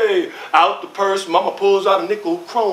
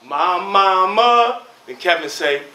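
usual speaking voice

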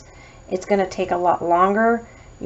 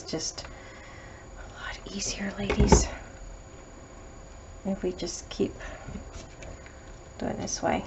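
whispering; 10 dB quieter than usual. Real, not acted. Unvoiced aside to viewers.

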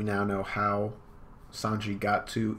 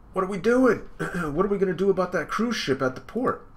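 high, loud voiced character; a high comic character voice; 9 semitones higher, 4 dB louder than usual. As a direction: read it as a raised, pushy manga character. Voicing a character reading manga.

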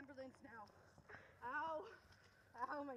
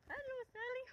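high sing-song hello; 11 semitones higher, 5 dB louder than usual. Real, not acted. Greeting the dog Sally, high cooing. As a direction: coo it like to a pet.

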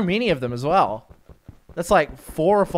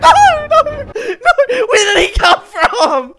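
shrieking, very high, half-laughing; squealed frantic pleas; panicked pleading; 7 dB louder than usual. Real, not acted. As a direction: beg for mercy through hysterical laughter. Attacked after taunting mob.

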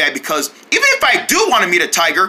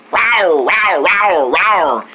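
playful drawn-out rawrs; pretend tiger roar; 3 semitones lower, much slower than usual.

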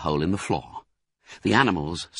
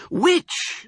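very high, rising question; two eager questioners. A narrator's reading. Badger and cub ask Fox, eagerly. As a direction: ask it agog with curiosity. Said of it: "both together".

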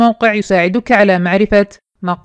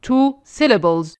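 slow and clear; 2 semitones higher, much slower than usual. Shown as a demonstration. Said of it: "teacher says it slowly".

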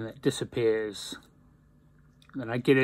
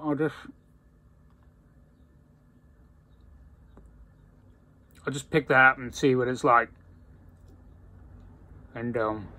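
talking around pipe stem; pipe in mouth; faster than usual. Real, not acted. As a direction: lips clamped on stem. Seen 1: Pipe stem held between lips while speaking, hand steadying bowl.

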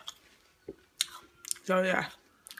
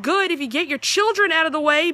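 annoyed retort; 7 semitones higher, 11 dB louder than usual. Retold or imitated. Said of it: "And I'm like".

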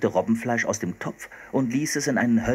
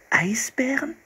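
puzzled repeat; slower than usual. Acted. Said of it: "wiederholte Anne".

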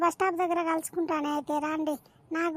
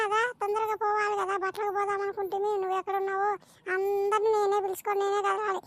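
ordinary talk

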